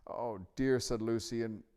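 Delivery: a mild wail of disappointment; dismayed. A narrator's reading. Lucy laments to the lion, dismayed.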